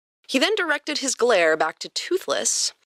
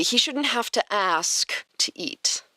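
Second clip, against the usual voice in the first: whispering; 3 semitones higher than usual. Acted. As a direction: whisper it sulkily. Toothless whispered aside.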